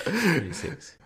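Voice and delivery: high, mock-shocked echo; incredulous. Real, not acted. Repeats the age in disbelief.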